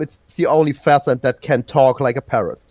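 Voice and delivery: quick run-through of the last option. Real, not acted. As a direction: rattle it off briskly. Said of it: "a little bit faster".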